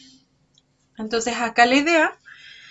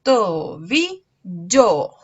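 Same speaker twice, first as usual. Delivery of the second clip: tobillo stretched out as to-bi-llo, leaning on llo; slow syllables; 2 semitones lower, 4 dB louder, much slower than usual. Shown as a demonstration. Teacher pointing out the final syllable, very slow. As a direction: draw out tobillo to show the last syllable. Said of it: "tiene por sílaba final".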